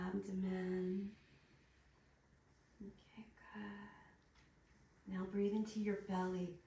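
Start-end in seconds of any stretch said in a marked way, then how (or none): 2.8-5.1 slow whisper. Real, not acted. Whispering to partner during massage.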